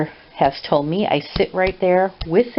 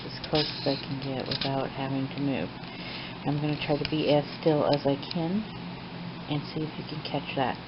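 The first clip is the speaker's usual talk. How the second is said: hushed late-night talk; 12 dB quieter than usual. Real, not acted. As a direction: talk quietly as if it's midnight. Late night, watching the cat quietly.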